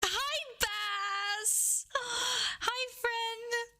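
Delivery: high delighted hello with a gasp; gasp, then squeal the greeting; thrilled greeting for a five-month subscriber; excited squeal. Real, not acted.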